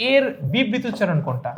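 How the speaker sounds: usual speaking voice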